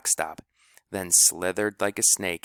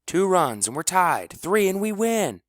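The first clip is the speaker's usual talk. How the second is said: excited shouting; 10 semitones higher, 4 dB louder than usual. A narrator's reading. Team cheering batter on.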